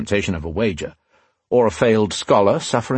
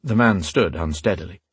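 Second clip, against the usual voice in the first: plain reading voice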